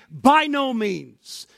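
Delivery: BY NO MEANS slow, high and drawn out; "By no means" weighty and slow, falling at the end; emphatic denial. Acted. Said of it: "emphatic statement, very strong in the Greek".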